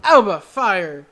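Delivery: loud, slow, drawn-out call; excited call. Real, not acted. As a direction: punch out the big kick, stretching each word. Calls a big kick live.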